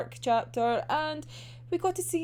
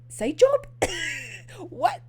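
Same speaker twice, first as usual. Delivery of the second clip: laughing disbelief; 13 semitones higher than usual. Real, not acted. Fan reacting to the side job, high laughing shock.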